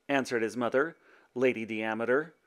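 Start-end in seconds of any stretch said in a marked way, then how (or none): none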